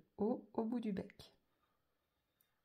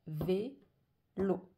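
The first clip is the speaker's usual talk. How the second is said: VÉLO split into vé and lo; a clear gap inside vélo; syllable by syllable; 5 dB louder than usual. Shown as a demonstration. Teacher counting two syllables in vélo, syllable by syllable. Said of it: "j'ai deux syllabes".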